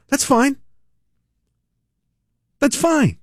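high, sing-song that's fine; brushing it off with mock cheer; mock sarcastic. Host mocking the quoted woman, high sarcastic.

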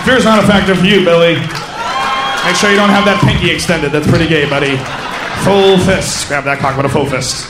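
loud, bossy pep-talk; hammed-up locker-room advice; mock coach. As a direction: bark it like a coach. Coaching the kid, crowd-work.

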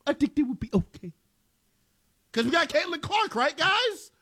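mock baseball fan. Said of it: "mocking Major League Baseball fans".

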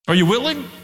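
high, rising call to the room; rising challenge. Real, not acted. Preacher to congregation, high challenge. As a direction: challenge them to answer. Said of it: "challenge to congregation".

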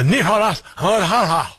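mock news-anchor Spanish accent on the name; exaggerated Spanish pronunciation of the name. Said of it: "I have to do that in a Spanish accent".

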